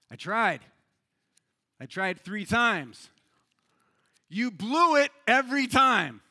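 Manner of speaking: measured reply with long pauses, building to a loud punchline; deliberate start, high loud finish; voice from heaven. Acted. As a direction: answer as an exasperated God. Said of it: "And then a voice came from heaven saying".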